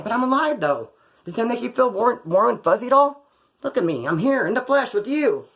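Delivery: mock sweet plea. To the girl angry about her wrecked car, mock tender. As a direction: coax her with fake sweetness.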